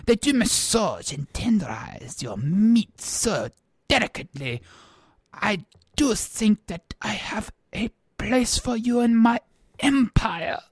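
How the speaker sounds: softly voiced French emperor; hushed, lingering French-accented compliment; Napoleon. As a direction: murmur admiringly in a French accent. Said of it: "and he said".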